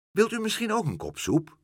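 high, courteous questioning; mother being polite to a visitor; polite hostess. A narrator's reading. Mother politely offers doctor soup.

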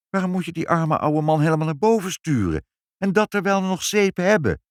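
high, rushed reproach; indignant protest. Acted. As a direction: object to mother, pitch well up. Father protesting to mother. (verontwaardigd, hoog protest)

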